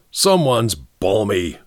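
a slow, lightly snarled grumble; mildly annoyed snarl, slowed down. A narrator's reading. Ellery dismissing his unknown visitor with a snarl. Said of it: "snarled Mister Queen".

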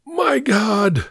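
high-pitched strangled exclamation; choked alarm. A narrator's reading. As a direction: cry out, high, as if being smothered. Gasped while being smothered with chloroform.

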